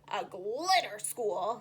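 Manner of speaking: slow grumpy puffer fish. Acted. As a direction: ponder the words slowly.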